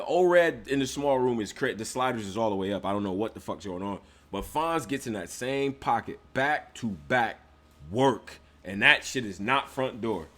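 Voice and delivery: usual speaking voice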